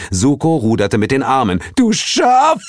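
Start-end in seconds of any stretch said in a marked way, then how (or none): 1.8-2.6 very high, loud panicked cry; shrieked insult; falling yell. Acted. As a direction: scream the insult as you topple. Yells while being pushed in.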